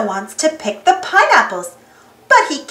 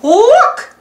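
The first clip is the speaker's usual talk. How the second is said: higher, louder cartoon mouse call; Mickey crying out; 8 semitones higher, 5 dB louder than usual. Mickey spots the giant M, high excited call. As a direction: call out like Mickey spotting something. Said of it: "cries Mickey".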